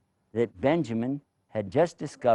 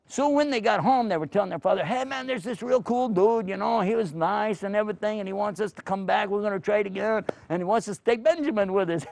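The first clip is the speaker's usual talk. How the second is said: excited brothers; 7 semitones higher than usual. Acted. Brothers excitedly telling father.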